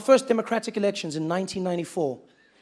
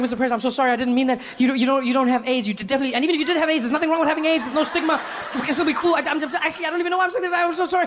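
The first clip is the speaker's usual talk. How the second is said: higher and fast, frantic rambling; frazzled apology; 7 semitones higher, faster than usual. Retold or imitated. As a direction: babble in embarrassed panic. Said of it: "I'm frazzled".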